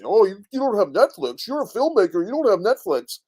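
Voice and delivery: high, incredulous imitation of Mark; mock disbelief. Friend Mark scoffing that a filmmaker lacks Netflix, high mock disbelief. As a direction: play Mark, shocked and a bit mocking, pitched high. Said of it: "he would say".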